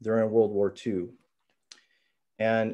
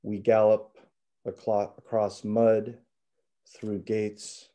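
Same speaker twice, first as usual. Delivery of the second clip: hushed raw poetry; slower than usual. Acted. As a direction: read it slow and intimate, with pauses. Reads second poem aloud.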